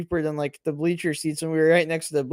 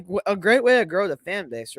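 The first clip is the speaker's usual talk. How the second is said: ordinary talk, nothing marked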